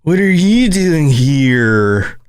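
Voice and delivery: very slow, stretched California drawl; laid-back mock Californian, every word dragged out; exaggerated Californian accent. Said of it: "think about the Californians and be like".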